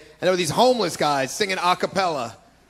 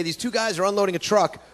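usual speaking voice

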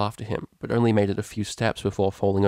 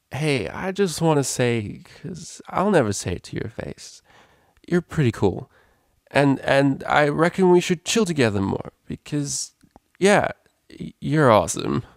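higher, halting tipsy confession; drunk gushing DJ; 6 semitones higher than usual. A narrator's reading. Drunk DJ telling her friend she's cool, gushing. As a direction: gush earnestly, stumbling over the words.

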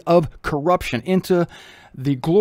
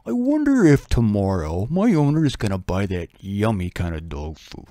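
dreamy dog; 9 semitones lower than usual. Retold or imitated. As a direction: muse like a dog about its dinner, low and trailing off. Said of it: "think of the future".

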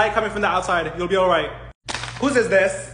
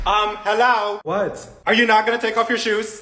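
ordinary talk, nothing marked

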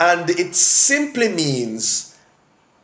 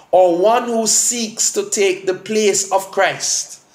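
normal talking voice

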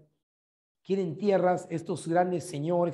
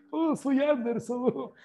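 mock pompous king; 6 semitones higher, slower than usual. Mocking the weak king.

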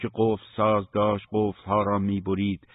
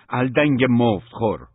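loud, higher-pitched yelled insult; enraged shout; 4 semitones higher, 8 dB louder than usual. A narrator's reading. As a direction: hurl the insult in anger. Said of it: "فریاد زد". (فریاد از سر خشم)

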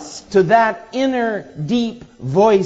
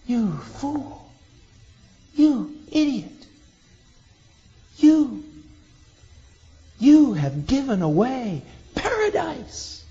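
lightly voiced bitter blame, higher pitch; mock scolding; 6 semitones higher, 3 dB quieter than usual. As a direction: scold the partner with contempt, drawn out. Adam and Eve blaming each other.

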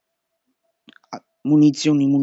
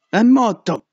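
excited cry; 6 semitones higher, 6 dB louder than usual. Real, not acted. Monster killed.

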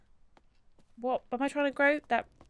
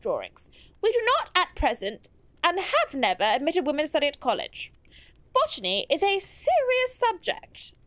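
put-on her own accent lightly poshed up, higher; poshed-up reading; 3 semitones higher than usual. Reading a rejection letter aloud, lightly posh official voice. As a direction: read the letter like a stiff college official. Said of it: "but poshed it up a little bit".

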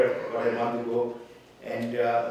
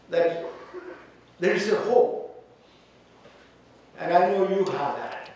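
raised, higher preaching; 6 semitones higher, 4 dB louder than usual. Acted. Pastor assuring the widow of hope, raised. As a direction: lift hope to them.

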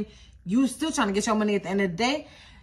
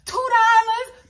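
high, loud and drawn out in disbelief; incredulous shout; 11 semitones higher, 8 dB louder, slower than usual. Retold or imitated. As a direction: shriek it back at her in mock shock. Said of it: "I said".

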